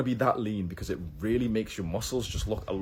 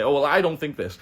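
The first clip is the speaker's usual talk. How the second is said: mock denial; 6 semitones higher, 7 dB louder than usual. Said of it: "they can lie about this and say".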